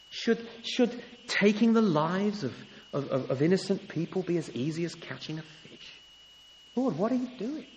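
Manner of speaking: high, pleading prophet crying out to God; voicing Habakkuk's plea. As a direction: plead with the Lord, lightly voiced. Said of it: "That's what Habakkuk's saying here".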